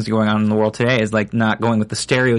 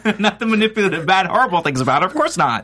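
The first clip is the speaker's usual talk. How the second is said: higher-pitched, laughing mock reassurance; joking mock denial; 7 semitones higher than usual. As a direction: deny it playfully through laughter.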